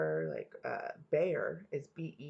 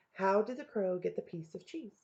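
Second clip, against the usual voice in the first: usual speaking voice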